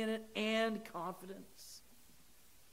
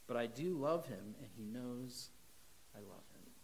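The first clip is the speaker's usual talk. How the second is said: lower, softer, fading almost to nothing; fading confession; 6 semitones lower, 8 dB quieter than usual. Acted. Mark confessing love to Jesus, fading softly. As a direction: let it die away into a murmur. Mark's humble reply to Jesus.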